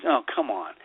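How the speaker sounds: ordinary talk, nothing marked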